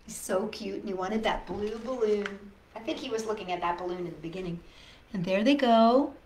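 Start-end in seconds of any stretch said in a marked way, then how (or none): none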